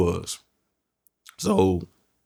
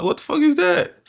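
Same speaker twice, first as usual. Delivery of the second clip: very high squeaky disbelief; baffled. Retold or imitated. Naive young inmate asking his cellmate, very high baffled squeak. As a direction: squeal it up high, totally lost. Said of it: "I'm like".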